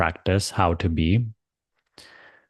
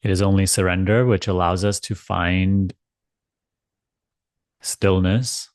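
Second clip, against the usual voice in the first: calm spiritual guidance, spacious and gentle; guided meditation. Acted. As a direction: ease them into letting go. Leads guided surrender meditation.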